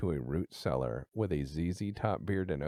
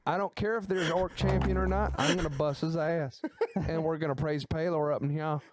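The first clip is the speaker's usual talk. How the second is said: higher, fired-up Texas farmer impression; old Texas farmer; 7 semitones higher, faster than usual. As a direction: imitate an old Texan boasting before a fight. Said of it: "And he's like".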